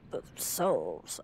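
soft, low echoed "Themselves"; mock echo. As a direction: let "Themselves" fade out low like an echo in a hall. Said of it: "there's an echo in this room".

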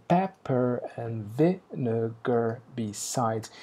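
"Pepper and vinegar besides" said very slowly, with a heavy beat on "Pepper" and each stress pulled apart; halting rhythm. Shown as a demonstration. Teacher showing the class the broken metre, slow halting stresses. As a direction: stumble deliberately through "Pepper and vinegar besides", one beat at a time. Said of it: "Ele só tem acentos".

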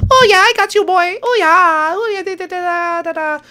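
squeaky, very high gloating singsong; high-pitched game character. Acted. Character celebrating catching the boy, squeaky. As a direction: crow it in a tiny squeaky voice.

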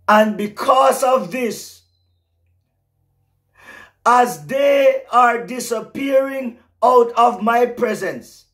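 high, loud prophetic preaching, slowing on the key words; fervent pulpit proclamation; preaching at full force. Acted. Preaching continues.

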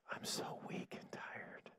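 slow, feeble whisper; weak and tired. Acted. Preacher voicing the weak one in scripture, exhausted whisper. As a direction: whisper it as if drained of all energy. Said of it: "Let the weak say".

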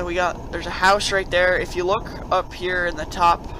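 usual speaking voice

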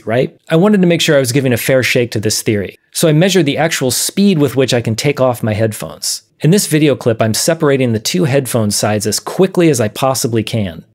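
normal talking voice

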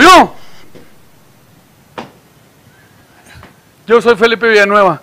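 high, loud proud announcement; announcing himself. Acted. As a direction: puff up and declare your name. Chiste, voz de personaje presumido. (presentación fanfarrona, aguda y fuerte)

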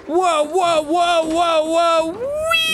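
very high, rising whoas; excited whoas. Real, not acted. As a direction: ride the thrill. Watching a wild ride.

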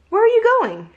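put-on high, loud child's call; calling kid. Jack calling out to the passing wagon. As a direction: call across the yard as a curious kid.

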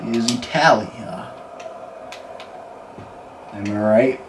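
usual speaking voice

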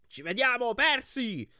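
high, loud mocking call; loud taunting shout at a rival. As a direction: yell it as you pull ahead. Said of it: "gridò Beckendorf".

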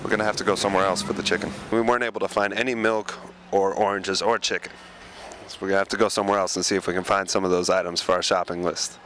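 ordinary delivery

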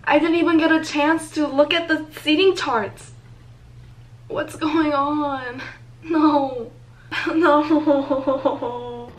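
loud, high-pitched protest; distraught outrage. Real, not acted. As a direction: wail in real frustration at the ticket site. Real outrage at losing the tickets.

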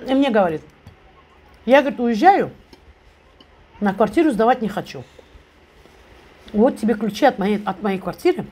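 pausing to chew; talking while chewing. Real, not acted. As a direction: mouth partly full. Seen 0: Takes forkful at -1; lips pressed, cheeks working between phrases.

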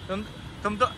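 ordinary delivery

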